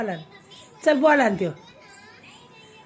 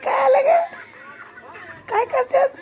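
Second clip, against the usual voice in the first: high, laughing delight; delighted squeal; 12 semitones higher than usual. Real, not acted. Mother squealing with delight at her toddler's antics.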